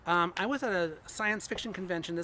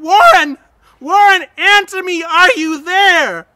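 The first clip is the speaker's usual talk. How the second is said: screaming a name into the phone, much higher and louder; desperate scream; 12 semitones higher, 23 dB louder than usual. Acted. Narrator screaming down the telephone for Warren, desperate scream. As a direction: scream for him, slow and desperate on the last words.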